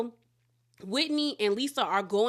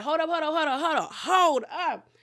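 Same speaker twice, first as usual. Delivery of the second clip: mock-urgent rapid-fire halt, raised pitch; retold frantic interjection; urgent interruption; 4 semitones higher than usual. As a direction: cut everyone off, imitating an alarmed friend. Acted interruption of the argument.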